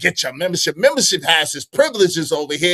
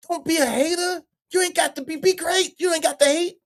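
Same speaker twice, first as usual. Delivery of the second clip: much higher, sing-song sermon to a hater; high preachy voice; 11 semitones higher than usual. Acted. Streamer scolding a chat hater, much higher and preachy. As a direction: lift the voice up high and preach it kindly.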